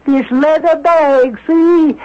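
ordinary delivery

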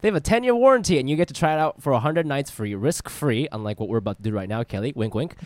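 smooth sponsor read voice. Acted. Host reading the mattress sponsor ad to his guest. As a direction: sell the mattress plainly and brightly.

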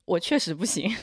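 ordinary talk, nothing marked

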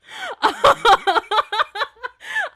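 a big, high burst of real laughter; loud laugh. Real, not acted. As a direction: crack up out loud at the joke.

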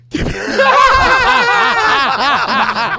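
high, loud burst of laughter; loud shrieking laugh. Real, not acted. Reacting to story.

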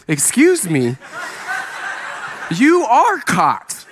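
incredulous, drawn-out retort with pitch jumping up; mock indignation. Youth pastor answering a caught student, mock indignation. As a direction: put the cheeky student straight.

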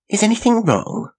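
high, lifted query; questioning passenger. A narrator's reading. Janet asks the untalkative man next to her, high and questioning. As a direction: voice a woman's question high above the narration. Asks silent neighbour on stalled coach.

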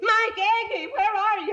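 calling out. Acted. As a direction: call out loud for someone in another room. Calling out for Mike Agee.

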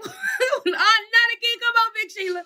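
high, whiny mock despair at the car; exasperated groan. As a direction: plead with the broken-down car, squeaking high. Car breaking down again.

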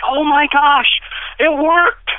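high, loud, thrilled yelling; excited shout. Retold or imitated. Overjoyed husband shouting in a car park. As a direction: shout it like you can't believe your luck. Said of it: "I was so excited".

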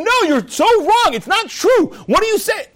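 high-pitched acted contradiction; heated comeback. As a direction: shoot back indignantly, voice way up. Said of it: "And the other guy's saying".

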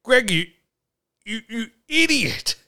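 rising hammed-up fury at Greg; mock rage. Host ranting at fan Greg, hammed-up rising anger.

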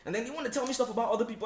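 ordinary talk, nothing marked

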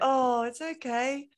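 high, slow, drawn-out soothing; mock coddling. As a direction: coo it with exaggerated sympathy. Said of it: "So they don't just go".

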